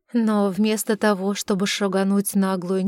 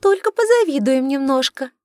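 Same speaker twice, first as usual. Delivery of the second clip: second mermaid voice; 5 semitones higher, 5 dB louder than usual. Acted. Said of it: "другой голосок".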